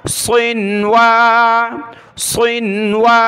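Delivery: सिनवा with a nasal hum joining नून and वा; nasal ghunnah. Shown as a demonstration. Teacher shows the nasal join in सिनवा, slow. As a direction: draw सिनवा out through the nose. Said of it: "नाक में आवाज़ पैदा की".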